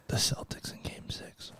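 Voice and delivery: unvoiced taunting whisper. Real, not acted. Host taunting Boston people about the Miami Heat, whispered. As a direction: drop to a slow, hushed whisper.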